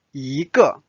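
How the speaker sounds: 一个 with 一 rising; second tone. Shown as a demonstration. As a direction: lift 一 in 一个 upward. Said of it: "It's the second tone".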